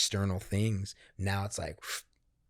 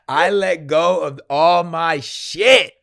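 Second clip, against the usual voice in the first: loud, high, slow exclamation; excited release; 8 semitones higher, 15 dB louder, slower than usual. Real, not acted. Telling the host about the ceremony, loud excitement. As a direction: belt it out with glee.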